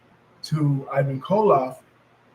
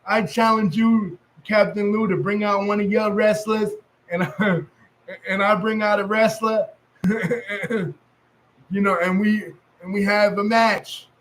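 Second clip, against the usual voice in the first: put-on higher, heavy New York accent, breaking into laughs; 4 semitones higher than usual. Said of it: "my heavy New York accent".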